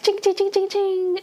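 high, rapid metallic chings; sing-song clinking sword noises; imitated sword clashing. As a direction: mimic clashing blades in a high voice.

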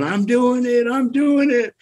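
high, thrilled yell of triumph; excited screaming. Retold or imitated. Angler to his friend on the river, high excited yell. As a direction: shout it like your first big fish is on. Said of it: "I start screaming".

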